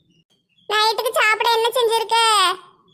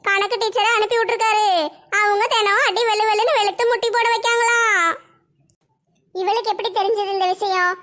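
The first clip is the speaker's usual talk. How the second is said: usual speaking voice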